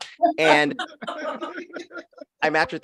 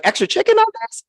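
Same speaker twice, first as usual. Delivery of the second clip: much higher, fast, squealing question; mock delighted quip; 3 dB louder, faster than usual. Comic retelling her reaction to the picture, much higher and fast. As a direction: squeal it like an excited diner ordering.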